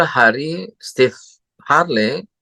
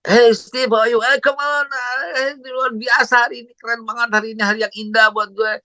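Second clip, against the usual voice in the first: much higher, beaming pep talk; now he's the upbeat one; excited greeting; 9 semitones higher than usual. Retold or imitated. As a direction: Steve turned cheerful, greeting much higher. Steve now greets cheerfully himself.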